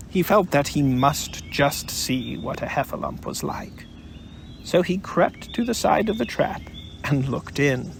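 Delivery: plain reading voice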